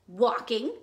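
loud, curt grumpy answer; grumpy bird. Acted. Grumpy Bird answering Sheep's question, loud and curt. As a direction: answer loudly, as if bothered.

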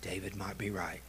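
low, hushed self-talk; quiet, dawning doubt, acted out; daughter thinking aloud. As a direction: murmur a doubt to yourself. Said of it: "she got to thinking".

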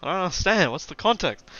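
loud, high, baffled protest; mock-frustrated outcry; exasperated confusion. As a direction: plead for sense, hammed-up and loud.

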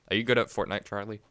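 normal talking voice

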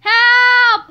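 high, loud, drawn-out yell; cry for help. A narrator's reading. Boys dragged behind the van, yelling for help. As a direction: yell out for rescue. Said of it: "they cried".

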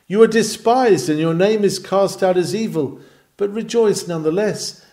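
ringing sermon climax, high and loud; fervent preaching. Acted. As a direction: lift the crowd with a loud cry. Preacher's rising exhortation.

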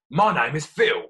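barked. Acted. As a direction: bark it at him. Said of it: "barked Philip".

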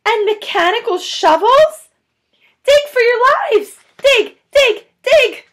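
high, loud frantic command; urgent shouting. Acted. Mister Fox shouting urgent orders to his family. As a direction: drive them to dig for their lives. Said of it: "shouted Mister Fox".

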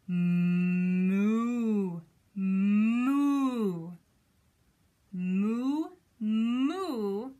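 long, drawn-out moos; imitated cow call; cow mooing. Shown as a demonstration. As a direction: moo loudly like a cow, holding the vowel. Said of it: "What does a cow say?".

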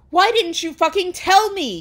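loud, high, hammed-up angry shout. A narrator's reading. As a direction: yell it as an outraged accusation. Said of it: "he shouted".

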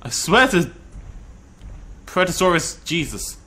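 ordinary talk, nothing marked

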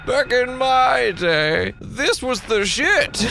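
put-on old-timer voice. Shown as a demonstration. Streamer reminiscing about old games, mock old-timer drawl. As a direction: drag out "Back in my day" like a nostalgic old man.

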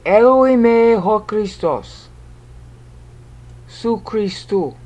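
slow, stretched-out syllables; drawn-out Greek reading. Shown as a demonstration. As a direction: read the Greek out slowly, holding the vowels. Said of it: "So if you were to read it".

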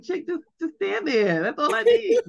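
half laughing, half crying his gratitude; imitating son, choked up. Son voiced thanking his mom, tearful and laughing. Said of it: "And he'd be like".

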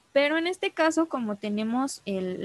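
ordinary delivery

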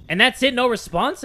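ordinary delivery

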